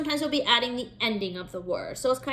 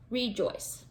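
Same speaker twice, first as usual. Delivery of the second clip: rejoice said again, softer; softer repeat; 6 dB quieter than usual. Shown as a demonstration.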